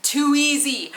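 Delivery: mock Australian. Shown as a demonstration. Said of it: "Too lazy?".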